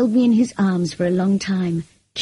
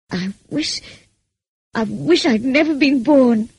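halting start, then raised pitch; anguished cry; 5 semitones higher, 3 dB louder than usual. A narrator's reading. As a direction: cry it out in despair. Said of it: "I cried".

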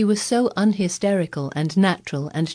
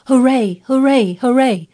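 loud, high cheering; shouted hooray; 8 semitones higher, 10 dB louder than usual. A narrator's reading. A strong boy cheering about the garden, loud shout. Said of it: "would probably have shouted".

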